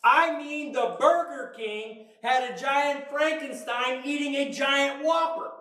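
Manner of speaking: ordinary talk, nothing marked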